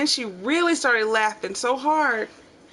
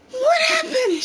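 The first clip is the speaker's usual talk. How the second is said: high, loud panicked question; half laughing, half frightened; a scared mother's cry, cracking; alarmed mom; 4 dB louder than usual. Retold or imitated. Mom sees blood on child.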